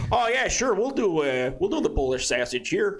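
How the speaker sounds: eager Chicago local, high opening; Chicago accent. Acted. Blending in with guys from Chicago, Chicago accent. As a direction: play a Chicago guy agreeing. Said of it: "bunch of guys from Chicago".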